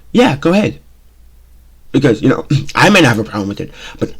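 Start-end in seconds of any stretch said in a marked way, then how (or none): 0.1-0.8 much higher mock permission; sarcastic permission. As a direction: grant it with a warning. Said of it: "It was more of a".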